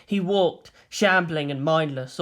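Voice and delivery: usual speaking voice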